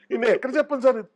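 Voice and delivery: high, pleading little voice; pleading fan. Retold or imitated. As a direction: beg like an eager fan. Fan's pleading reply voiced. (ఎక్కువ శృతిలో బతిమాలుతూ)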